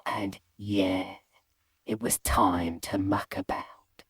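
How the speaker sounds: low, soft, slow near-whisper; conspiratorial hush. Acted. Boy alone in the bathroom, secretive hush. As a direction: drop the voice and savour the mischief.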